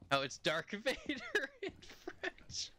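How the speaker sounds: laughing through the words, high; amused, half laughing. Real, not acted. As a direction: giggle while saying it. Laughing at French name.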